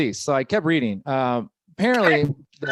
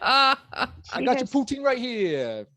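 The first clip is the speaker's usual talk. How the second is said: mock New Yorker; 8 semitones higher, slower than usual. Acted New York guy boasting.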